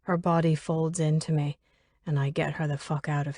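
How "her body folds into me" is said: ordinary delivery, nothing marked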